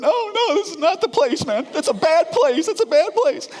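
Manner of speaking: high, loud panicky pleading; patient panicking on the table, high frantic pleading; panicked protest. Retold or imitated. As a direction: beg him not to, voice up high in panic. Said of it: "I'm going".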